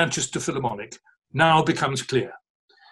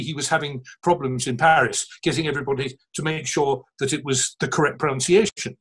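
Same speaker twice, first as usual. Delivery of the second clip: normal talking voice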